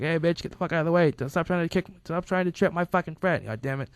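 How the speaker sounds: high-pitched irate imitation; mock scolding. Friend yelling at his dogs, mock angry.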